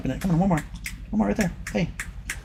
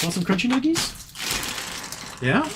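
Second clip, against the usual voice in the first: coaxing pet talk; 7 semitones higher, 5 dB quieter, much slower than usual. Real, not acted. Offering treats to dog.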